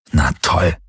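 low, dry exasperation; frustrated. A narrator's reading. Galen hears bad news from Helix, low frustrated grumble. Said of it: "dann schnaubte er frustriert".

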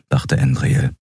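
ordinary delivery

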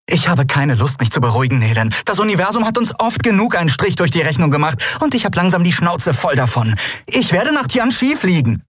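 put-on high, fast, fed-up young woman; she's had enough and says so; defiant Endriel. A narrator's reading. Endriel tells Nelen she will fly to Tianchi, high and fed up. Endriel defies her friends.

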